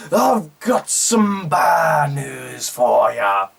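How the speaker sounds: very slow, drawn-out booming catchphrase; Wade Barrett. Retold or imitated. Barrett taking the microphone after the attack, hammed-up slow announcement. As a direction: proclaim it grandly, stretching every word.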